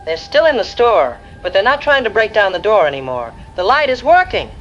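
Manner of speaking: frightened. Acted. Trapped woman reporting creatures outside.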